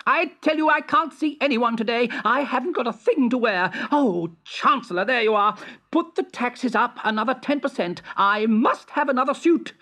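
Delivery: high, petulant royal voice, demanding; a vain emperor whining for clothes; spoiled emperor. Acted.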